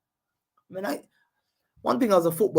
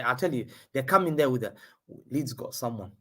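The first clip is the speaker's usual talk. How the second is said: ordinary talk, nothing marked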